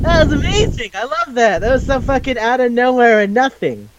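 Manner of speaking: high-pitched, tickled enthusiasm; delighted. Real, not acted. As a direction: gush in a high voice, loving the absurdity. Delighted reaction to favourite scene.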